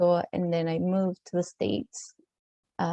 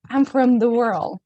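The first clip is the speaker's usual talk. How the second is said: higher, louder playful answer; joking; 4 semitones higher, 5 dB louder than usual. Retold or imitated. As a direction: toss it out jokingly. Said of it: "in a jokingly way".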